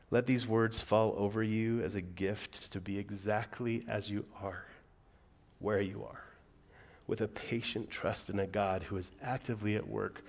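soft, calm prayer read aloud. Acted. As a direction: read it gently like a blessing.

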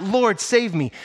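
desperate cry. Acted. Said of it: "he cried out".

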